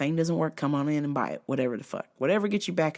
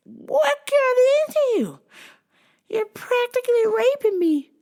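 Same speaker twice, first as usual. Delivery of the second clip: high, whispery mock wife voice; wife's voice; 4 dB louder than usual. Husband quoting his shocked wife, in a high mock voice. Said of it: "my wife is like".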